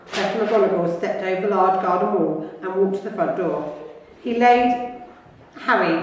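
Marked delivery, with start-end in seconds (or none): none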